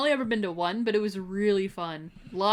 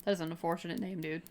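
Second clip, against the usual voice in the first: voice cracking; 6 dB quieter, faster than usual. Real, not acted. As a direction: voice cracks mid-phrase. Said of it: "My voice just cracked there".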